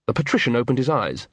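usual speaking voice

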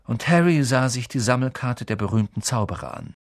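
ordinary delivery, nothing marked

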